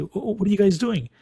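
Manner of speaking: high-pitched curious bird question; lightly acted flock, voice lifted high. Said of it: "They come say".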